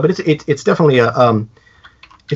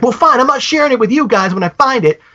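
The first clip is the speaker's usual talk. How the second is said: put-on huffy kid; 9 semitones higher, faster than usual. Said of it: "he's like".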